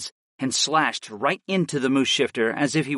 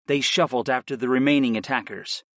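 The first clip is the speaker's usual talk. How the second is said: ordinary delivery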